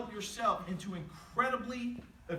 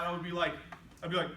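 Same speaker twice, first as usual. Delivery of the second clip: normal talking voice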